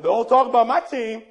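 loud, defensive outburst; mock indignant fan. Sports fan defending wrestling, loudly indignant. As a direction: push it out loud in mock offense.

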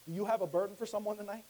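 usual speaking voice

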